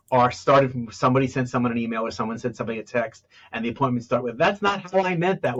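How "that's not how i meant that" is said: high-pitched, mildly indignant retort; mock defensive protest, pitched up. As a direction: imitate a client objecting to being misread. Acted quote of upset client.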